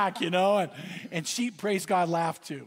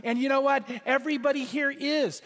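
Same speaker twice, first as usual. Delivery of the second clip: raised, emphatic preacher voice; preaching; 7 semitones higher than usual. Real, not acted. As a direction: proclaim it to the congregation. Preaching at full force.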